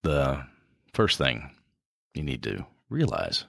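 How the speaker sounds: slow with long pauses. Shown as a demonstration. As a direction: drag out every phrase. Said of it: "slow it down".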